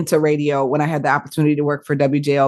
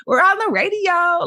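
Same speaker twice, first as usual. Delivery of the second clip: put-on high-pitched, untrained young radio voice; college freshman host; 13 semitones higher than usual. Ex-host mocks her freshman radio show, high-pitched kid voice. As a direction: play an excited college kid on air, pitched way up. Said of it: "eighteen-year-old freshman college student".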